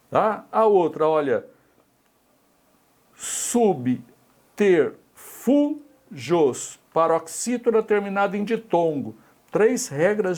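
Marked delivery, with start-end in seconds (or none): 3.1-6.8 slow, drawn-out example word. Shown as a demonstration. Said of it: "teacher reads example word slowly".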